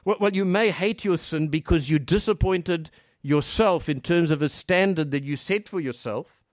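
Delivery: usual speaking voice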